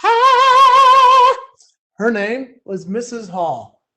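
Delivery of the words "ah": a loud, very high sung "Ah!"; operatic high note. Retold or imitated. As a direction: hit a soaring operatic note. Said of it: "this lady went".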